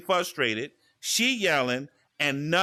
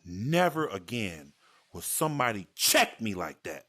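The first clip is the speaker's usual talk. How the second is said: solemn vow; 9 semitones lower than usual. Retold or imitated. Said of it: "I said".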